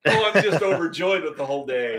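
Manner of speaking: amused, laughing overjoyed remark. Real, not acted. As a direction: joke it out through a laugh. Joking after the show recovers.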